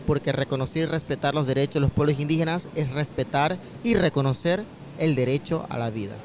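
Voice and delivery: usual speaking voice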